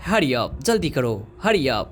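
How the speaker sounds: usual speaking voice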